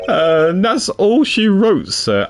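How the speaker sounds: detective character voice. Acted. Reads detective character lines.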